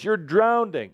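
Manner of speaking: high-pitched mock alarm; mock scream. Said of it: "It's gonna be that scream".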